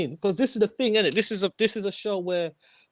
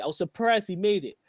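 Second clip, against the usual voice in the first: normal talking voice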